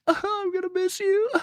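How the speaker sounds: put-on high, whimpering kid voice saying goodbye; mock crying goodbye. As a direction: squeak it out like a tearful little kid. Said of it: "I was crying to my hair".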